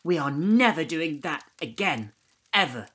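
loud, firm and high, no arguing; stern. A narrator's reading. Ellie telling Jordan off after escaping, loud stern. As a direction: lay down the law.

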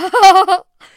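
sudden high loud cackle; loud shrieking laugh. Retold or imitated. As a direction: burst out laughing hard. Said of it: "börjar hon skratta". (högt, gällt skratt)

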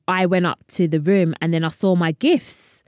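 usual speaking voice

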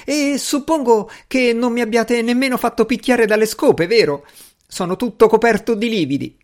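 high-pitched aggrieved protest; indignant servant. A narrator's reading. Servant showing his bruises as proof, indignant. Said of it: "gridò l'uomo".

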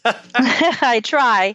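laughing through the words; a real laugh spilling into the reply. Real, not acted. Laughing at host's compliment.

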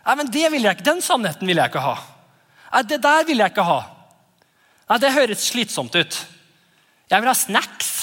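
put-on high kid voice refusing, wanting snacks; child refusing the truth and asking for snacks; whiny child. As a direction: play a picky child turning away the food. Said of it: "får vi høre at".

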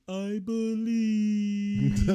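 high, drawn-out, awestruck I believe; slow high beetle cry. Retold or imitated. Beetle leaping the ramp, drawn-out high cry. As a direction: stretch it out like a triumphant beetle taking the ramp. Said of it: "and he goes".

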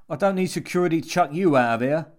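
fast, loud and high; angry threat. A narrator's reading. Jimmy marches at the intruder to chuck him out, angrily.

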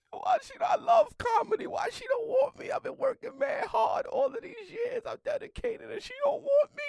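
high, loud, hammed-up crying plea; mock wailing. Comic lamenting he was rejected despite years of work, mock wailing.

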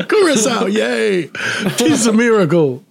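high-pitched hammed-up cheer; mock wonder. Host joins the curacao riff, mock wonder.

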